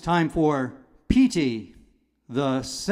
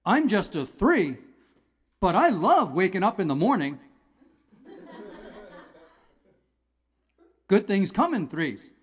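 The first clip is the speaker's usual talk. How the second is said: cheerful number; 4 semitones higher than usual. Acted. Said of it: "here's a positive integer".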